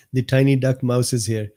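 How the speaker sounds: ordinary delivery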